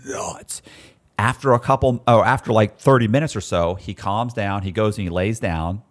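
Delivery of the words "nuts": unvoiced whisper under the breath; whispered aside. Other cellmate whispering in fear from below. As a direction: whisper it so the big guy can't hear. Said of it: "looking up like".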